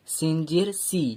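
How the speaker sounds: normal talking voice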